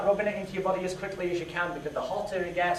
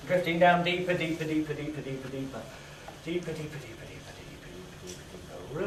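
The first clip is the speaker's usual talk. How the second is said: hypnotic deepening; 5 semitones lower, 7 dB quieter than usual. Acted. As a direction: lull them down deeper and deeper.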